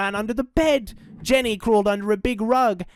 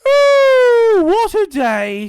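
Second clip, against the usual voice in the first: loud, high yawn sliding down into words; big yawn; 10 dB louder, much slower than usual. A narrator's reading. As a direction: yawn right through the line, drawn out. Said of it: "he gave a big yawn".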